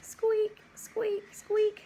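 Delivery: high squeaky creaks; squeaking chair. Acted. Teacher reading farm book, high squeaky rocking chair.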